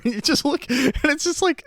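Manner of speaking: high-pitched, laughing through the words; real laughing. Real, not acted. Reviewer recalling the funny shower cut, laughing. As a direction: crack up while trying to describe it. Amused by film's awkward cut.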